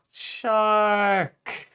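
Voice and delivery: loud high shout of alarm; shouted warning. Acted. Turtle warning friends of the shark, loud high shout. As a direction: yell the warning as the shark appears. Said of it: "the turtle shouted".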